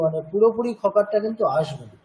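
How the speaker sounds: usual speaking voice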